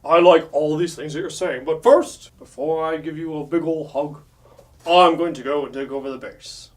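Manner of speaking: lightly voiced cartoon villain; a hint of a grand villain, punching "first"; Megatron. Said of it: "And Megatron's like".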